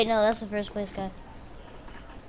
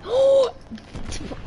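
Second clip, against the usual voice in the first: sharp, high-pitched gasp; startled gasp; 12 semitones higher, 4 dB louder than usual. Real, not acted. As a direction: gasp aloud in sudden surprise. Startled by in-game event.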